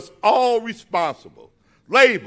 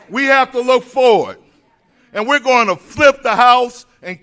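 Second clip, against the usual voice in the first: loud, high rally cry; podium speech; 6 semitones higher, 8 dB louder than usual. Real, not acted. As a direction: rouse the crowd, shouting out. Rally speech rising to a peak.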